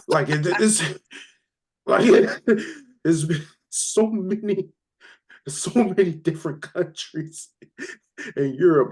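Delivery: high-pitched laughter breaking the words; laughing through it. Real, not acted. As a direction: laugh helplessly while talking. Laughing at the absurd remark.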